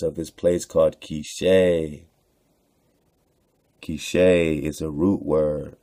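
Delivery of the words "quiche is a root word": ordinary delivery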